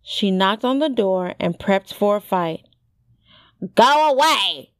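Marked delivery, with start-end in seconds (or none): 3.8-4.7 gruff, raised-pitch dismissal. A narrator's reading. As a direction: send them off gruffly. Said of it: "came the gruff voice".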